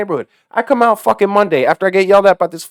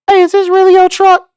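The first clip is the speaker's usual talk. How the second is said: put-on cheeky kid; 12 semitones higher, 4 dB louder than usual. Kid cheekily asking driver.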